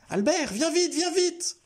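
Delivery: put-on high, urgent child's cry for help; a frightened little girl shouting for Albert; panicked girl calling. A narrator's reading. Frightened girl calls Albert for help, high and urgent. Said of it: "Les hurlements de Memouna".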